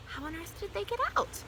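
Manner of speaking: high, puzzled question; wondering. A narrator's reading. Teacher puzzling over the loose pets, wondering. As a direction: ask it in high-pitched bafflement. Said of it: "Missus Brisbane wondered".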